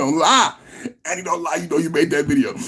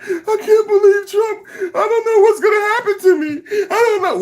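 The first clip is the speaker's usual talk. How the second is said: much higher, sobbing with laughter; mock crying; 12 semitones higher than usual. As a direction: imitate her crying TikTok with a laughing wail. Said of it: "She made a TikTok".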